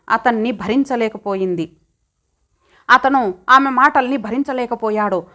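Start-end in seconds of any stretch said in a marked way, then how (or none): none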